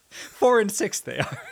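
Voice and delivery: put-on slow, high old-timer drawl; lightly voiced old prospector. Old-timer aside about kids.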